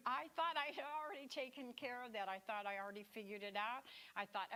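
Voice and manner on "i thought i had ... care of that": usual speaking voice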